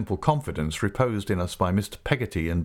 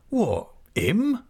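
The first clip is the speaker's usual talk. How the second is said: put-on a girl's startled, higher question; a touch of a girl's surprise; Emily answering her uncle, lightly surprised; young girl surprised; 11 semitones higher than usual. A narrator's reading. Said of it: "says Emily".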